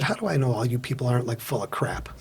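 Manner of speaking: a sly dig at the group; sly low challenge. Retold or imitated.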